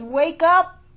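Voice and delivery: loud, high wake-up cry; shofar's call. Acted. The shofar calling the people, loud and high. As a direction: blast it like a shofar. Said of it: "the shofar says".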